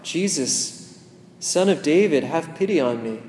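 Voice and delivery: crying out. Pretend. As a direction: call out desperately to Jesus from the roadside. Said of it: "that makes you cry out".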